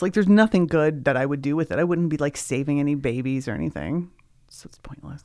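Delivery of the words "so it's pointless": hushed unvoiced aside; whispering. Real, not acted. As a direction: whisper it like a secret. Joking aside about nefarious invisibility.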